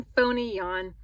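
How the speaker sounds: ordinary delivery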